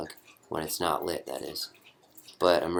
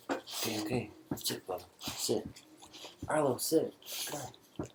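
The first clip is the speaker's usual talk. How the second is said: hushed, higher-pitched pet commands; coaxing a dog; 6 semitones higher, 7 dB quieter than usual. Real, not acted. Owner tells big dog Arlo to sit, hushed coaxing. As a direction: softly urge a dog to settle.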